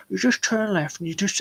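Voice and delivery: high put-on copy of a stranger's voice; mimicking the direction-giver. Impressionist copying the bloke as he walks away, high mocking mimicry. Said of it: "And then he goes".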